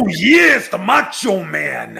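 loud wrestler cry, slow on the name; Macho Man voice. Acted. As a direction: announce yourself like a wrestling star. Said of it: "he's starting again".

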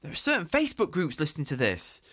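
high frantic dude; panicked scolding. Acted. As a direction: hush him in a panic. Panicked host scolding guest.

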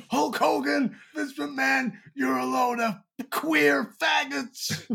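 put-on high, slow American accent. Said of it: "the American accent you put on for that".

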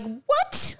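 a high incredulous what; shocked what. Retold or imitated. Mother reacting to her son's venom comment, high and incredulous. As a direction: squeak out your disbelief. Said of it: "I'm like".

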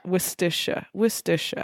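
Worcestershire quietly, wuss-ter-sher; Worcestershire practised under the breath; Worcestershire tried out softly; trying the local pronunciation. Shown as a demonstration. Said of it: "Is that right?".

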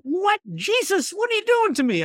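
high, squeaky mock protest; mock outrage. Jokingly protesting to Jesus about the yoke, high mock outrage.

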